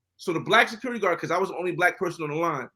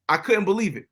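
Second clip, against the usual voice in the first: normal talking voice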